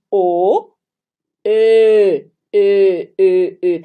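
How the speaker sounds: loud "E" said with lips rounded as for O; rounded ö sound. Shown as a demonstration. Teacher drilling the German Ö out loud. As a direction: shape an O and voice E. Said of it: "boca do O e vai pronunciar E".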